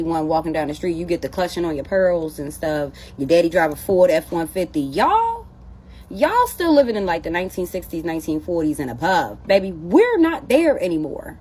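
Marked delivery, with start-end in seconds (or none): 4.8-7.1 high and loud, mock-exasperated y'all; a teasing reprimand, way up high; sassy scolding. Real, not acted.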